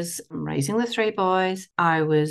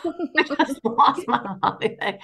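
laughing through the words, a bit higher and slower; 4 semitones higher, slower than usual. Real, not acted. Telling the host about the laundry door, laughing. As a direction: giggle it out at yourself. Laughing at her own story.

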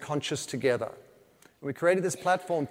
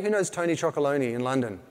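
normal talking voice

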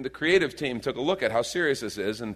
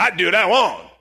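loud, higher catchphrase shout; mock defiance; 6 semitones higher, 8 dB louder than usual. Joking reply to wife's request, loud mock defiance. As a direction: blurt it out in playful rebellion. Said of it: "Lori tells me to do something".

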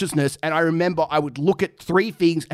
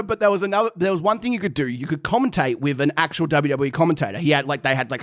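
ordinary talk, nothing marked

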